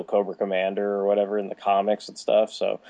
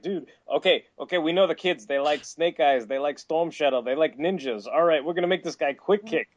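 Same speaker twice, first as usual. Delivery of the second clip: lightly voiced exec, higher and eager; pitching executive; 7 semitones higher than usual. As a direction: sell a silly idea like a toy exec. Imitated exec pitches Quick Kick.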